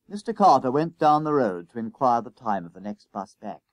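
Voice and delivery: ordinary delivery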